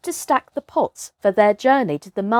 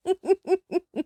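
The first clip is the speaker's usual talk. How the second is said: laugh; 9 semitones higher, 5 dB quieter than usual. A narrator's reading. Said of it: "Mowgli's shoulders shook".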